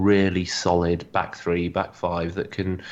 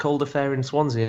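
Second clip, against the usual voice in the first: ordinary talk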